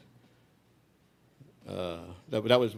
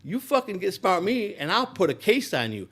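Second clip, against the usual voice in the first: put-on threatening old boss; 6 semitones higher, 5 dB louder than usual. Owner threatens to frame the speaker, high and loud. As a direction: threaten him in a much higher, louder voice. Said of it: "He told me".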